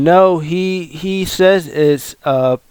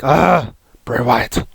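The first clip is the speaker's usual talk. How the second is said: imitating a gruff puppet boss; rough-voiced mock boss; Vince McMahon; 2 semitones lower, slower than usual. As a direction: put on a rasping boss voice. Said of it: "Vince McMahon puppet comes".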